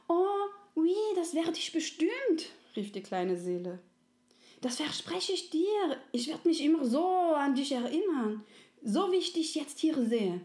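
high, bright eager promise; little soul. Acted. Little soul vows to remember, high and eager. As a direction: promise with all your heart.

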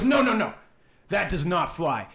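high, loud opening protest; emphatic refusal. Acted. Clyde rejecting the booth lady's excuse, high and emphatic. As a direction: cut the excuse off, voice jumping up.

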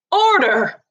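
loud, high call for order; shouted. Acted. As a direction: bang the gavel with your voice. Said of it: "shouted Missus Barron".

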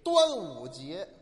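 drawn-out 端午节 said deliberately; slow confident answer. Acted. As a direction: spell out the answer slowly, pleased.

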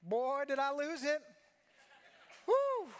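slow, high cry of excitement ending in a loud whoop; excited outburst. Real, not acted. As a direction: let it burst out, then whoop. Recalling breaking down in worship.

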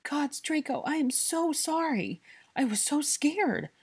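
high, distraught, tearful apology; bawling. A narrator's reading. Hermione clinging to Draco, bawling into his shoulder. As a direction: wail it out, stretching the key words. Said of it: "she bawled into his shoulder".

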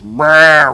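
loud, drawn-out imitated power-tool roar; impact gun noise. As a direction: blurt out the gun's ramming noise.

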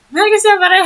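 high-pitched giddy declaration of love; squealy fangirl gush. Pretend. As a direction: squeal it like an overexcited fan. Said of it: "Wow, nag-iba na yun".

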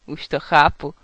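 loud, amused remark; amused realisation. A narrator's reading. Knight understanding her secret, amused. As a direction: say it on the edge of laughter. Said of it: "A rozchechtal se".

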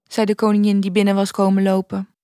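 plain reading voice

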